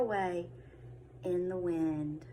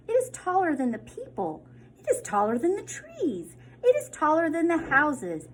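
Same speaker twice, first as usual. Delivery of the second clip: high, growing louder amazement; building wonder; 7 semitones higher, 5 dB louder than usual. A narrator's reading. Reader marvelling at the giant plant, higher and louder. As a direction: build it up each time.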